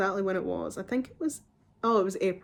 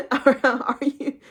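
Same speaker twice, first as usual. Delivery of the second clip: incredulous laugh; 5 semitones higher, 7 dB louder, much slower than usual. Real, not acted. Laughing disbelief at doctor count.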